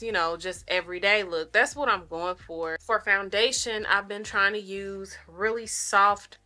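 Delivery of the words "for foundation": ordinary delivery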